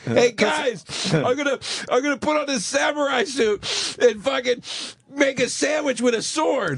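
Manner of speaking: high-pitched parody of a comedian pitching; mock his excitement in a high voice; mocked eager comic. Mocking a coked-up comic's pitch.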